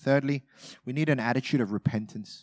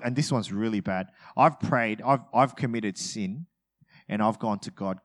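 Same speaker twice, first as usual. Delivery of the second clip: ordinary talk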